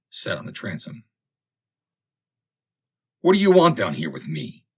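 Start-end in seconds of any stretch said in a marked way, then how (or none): 3.2-4.6 loud, hostile snarl. A narrator's reading. Forsyth confronting Samson in the cabin, snarling. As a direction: bite the words out at him. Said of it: "asked Forsyth in a snarl".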